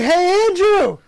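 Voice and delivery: big bright "hey" shout; calling out a greeting. Retold or imitated. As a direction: hail a cousin from across the way. Calling out to mistaken cousin.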